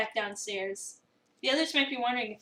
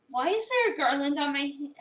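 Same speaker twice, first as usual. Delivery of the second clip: ordinary talk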